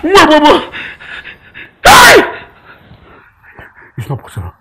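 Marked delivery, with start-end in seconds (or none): 1.8-2.4 sudden high yelp of "hey"; anguished cry. Acted. Uncle realising he is finished, anguished cry. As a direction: yelp it out in despair. Man reacts to losing everything.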